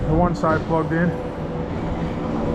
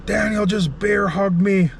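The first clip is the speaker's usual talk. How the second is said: put-on slow, higher kid voice, telling on a friend; little kid tattling; 5 semitones higher, slower than usual. Little kid reporting the bear hug to the parents, slow and higher. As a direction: play the six-year-old running to the grown-ups. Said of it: "he goes".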